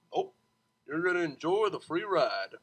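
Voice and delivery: lightly voiced easygoing driver; a hint of a smooth cabbie; charming driver. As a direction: wave it off with charm.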